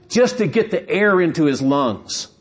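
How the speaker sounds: ordinary talk, nothing marked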